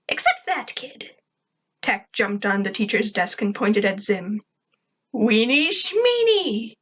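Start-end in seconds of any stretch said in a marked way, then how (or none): 0.1-1.2 high, put-on girl voice; single someone out in a pitched-up character voice; high new-girl voice; Tack. 5.1-6.8 high, drawn-out scoff; mocking character voice, pitched way up; Zim.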